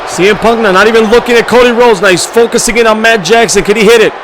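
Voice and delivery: loud, driving ringside commentary; hyped play-by-play. Acted. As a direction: call the match like an arena announcer. Live wrestling match commentary.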